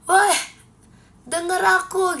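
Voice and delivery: high, loud strained call for attention; demonstrated yell, pushed high; hoarse attempted shout. Shown as a demonstration. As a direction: yell out through a worn-out voice. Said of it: "mau teriak itu kayak".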